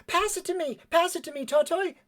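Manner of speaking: high-pitched frantic demand; high, excited kid shout; urgent calling. Acted. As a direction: call out for it, high and eager. Calls urgently during a tackle.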